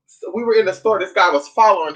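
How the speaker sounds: ordinary talk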